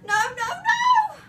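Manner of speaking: very high, slow, loud squeak; a tiny frantic hamster plea. A narrator's reading. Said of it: "I squeaked".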